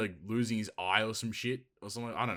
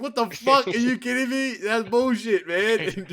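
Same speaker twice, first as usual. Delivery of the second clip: very high, loud comic outrage; mock fury; 10 semitones higher, 8 dB louder than usual. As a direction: yell like a hammed-up cheated fan. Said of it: "you get mad".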